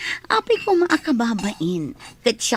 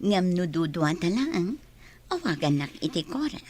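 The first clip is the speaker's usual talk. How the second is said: tender invitation; 5 semitones lower, 8 dB quieter than usual. Acted. Boss invites first-name use privately.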